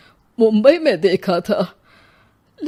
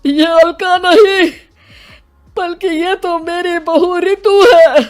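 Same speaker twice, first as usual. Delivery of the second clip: loud, high anguished revelation; shocked outcry; 8 semitones higher, 6 dB louder than usual. Acted. Father recognising his daughter-in-law at the station, shocked cry. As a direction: cry it out, stunned, far above usual pitch.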